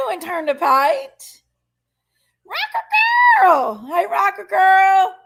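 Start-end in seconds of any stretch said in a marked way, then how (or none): 2.4-5.1 calling out a greeting. Real, not acted. Greeting a viewer in live chat.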